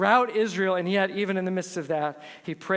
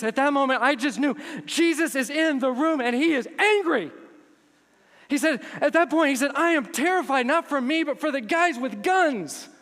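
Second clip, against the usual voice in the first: intense retold fear; 7 semitones higher, 4 dB louder than usual. Retold terror in God's presence.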